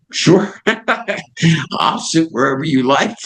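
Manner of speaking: high, giddy yes breaking into a laugh. Retold or imitated. Host accepting B B King's invitation, laughing with delight up high. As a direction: laugh through the answer, thrilled. Overjoyed answer to B B King.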